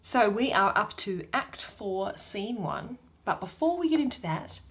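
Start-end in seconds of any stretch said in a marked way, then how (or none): none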